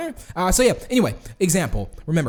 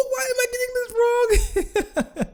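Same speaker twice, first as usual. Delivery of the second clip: laughing; slower than usual. Real, not acted. Frustrated laugh over wrong block.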